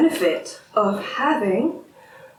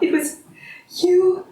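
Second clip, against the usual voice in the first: high, emphatic pleading anger; impassioned outburst; 5 semitones higher than usual. Acted. Spouse insists it was never us, high and impassioned. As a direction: drive it home, desperate to be understood. Marital grievance reaches its peak.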